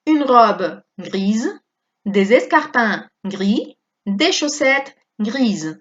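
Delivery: slow phrases, grise with a voiced Z; slow model reading. Shown as a demonstration. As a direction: read slowly, buzz the Z in grise. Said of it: "S entre dos vocales". (lectura lenta con S sonora)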